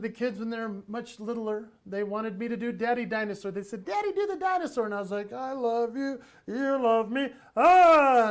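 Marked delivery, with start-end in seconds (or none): 3.8-4.8 put-on high, quick child voice; excited kid's request; begging little kids. Kids begging dad to play. 5.3-8.3 singsong dinosaur rising to a loud cry; playful kiddie-dinosaur voice; children's dinosaur act. Retold or imitated. Dad's dinosaur act for kids.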